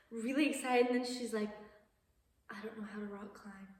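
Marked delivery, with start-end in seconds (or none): 2.5-3.7 hushed, quick panicked whisper; worried whisper. Retold or imitated. Natalie, worried she can't rock climb, whispering. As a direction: whisper it like a secret you regret.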